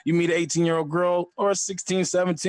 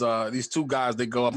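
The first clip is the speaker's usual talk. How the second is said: ordinary talk, nothing marked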